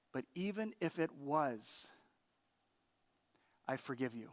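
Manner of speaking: a hint of the wife's gentle forgiveness; tender forgiveness. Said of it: "She said to me".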